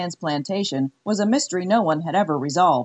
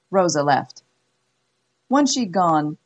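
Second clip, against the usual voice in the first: ordinary delivery, nothing marked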